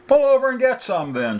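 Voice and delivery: firm high female voice giving an order; instructing. A narrator's reading. Woman instructing driver to pull over, firm. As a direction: tell him to pull over, firmly. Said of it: "she instructed".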